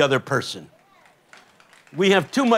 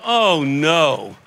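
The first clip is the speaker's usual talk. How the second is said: drawn-out comic dismay; mock dismay; 4 dB louder than usual. Preacher realises he has been set up, mock dismay. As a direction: let the dread sink in, playfully. Retold sinking thought on being set up.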